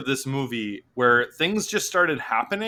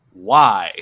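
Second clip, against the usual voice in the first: laughing why; 8 semitones lower, 4 dB louder than usual. Retold or imitated. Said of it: "And I went".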